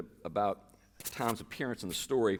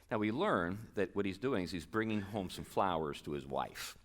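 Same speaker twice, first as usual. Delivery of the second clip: ordinary talk, nothing marked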